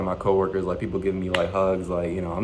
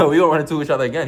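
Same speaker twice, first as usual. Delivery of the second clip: higher, louder, through a laugh; amused laughing repeat; 5 semitones higher, 6 dB louder, much faster than usual. Real, not acted. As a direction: crack up at your own boast. Laughs while repeating his own boast.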